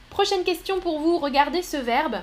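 normal talking voice

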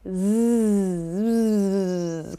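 a long buzzing "z" like a mosquito. Shown as a demonstration. Teacher buzzes like a mosquito. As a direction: buzz the z like an insect. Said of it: "comme un insecte, un moustique".

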